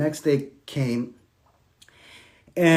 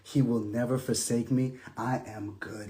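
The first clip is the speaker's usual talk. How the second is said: resigned surrender; 6 semitones lower, 10 dB quieter than usual. Retold or imitated. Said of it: "and I said".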